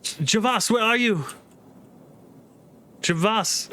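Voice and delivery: high-pitched searching call; woman calling out. Acted. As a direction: voice a woman calling for someone lost. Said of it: "and she's like".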